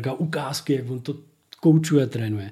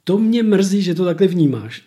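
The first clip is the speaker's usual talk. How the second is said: raised and louder, mocking; a mock narcissist's line, louder and higher; mocking quote; 5 semitones higher, 6 dB louder than usual. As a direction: throw the catchphrase back in a mocking tone. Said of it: "ta hláška".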